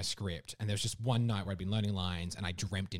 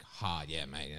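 put-on low, drawled broad Australian accent; 6 semitones lower, 4 dB quieter, much slower than usual. Mocking the other Australian, broad Australian accent. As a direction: lay on an ocker Australian accent. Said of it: "strong Australian accent".

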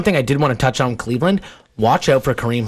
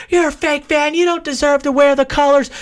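mocking angry fans; 13 semitones higher than usual. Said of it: "these fans get torn apart".

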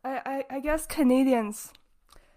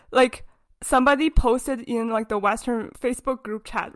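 usual speaking voice

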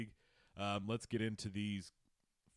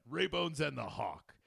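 slow, loud announcer voice; radio announcer; 4 semitones higher, 7 dB louder, slower than usual. Pretend. Host hyping the show name, announcer style. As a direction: boom it out like a radio intro.

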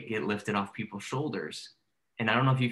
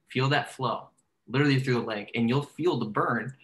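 guided focus; 2 semitones higher than usual. Shown as a demonstration. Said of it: "please try this".

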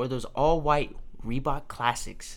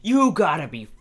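a hammed-up exasperated mother, louder; mock furious mom; 3 semitones higher, 7 dB louder than usual. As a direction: imitate mom snapping in disbelief. Angry mom scolding sons.

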